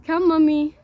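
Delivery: high, loud coaxing call; real motherly call to a little one. Real, not acted. Mother calls her upset child to come, coaxing.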